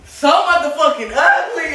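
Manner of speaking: loud drawn-out yell; shouting. Real, not acted. Shouting insult at something.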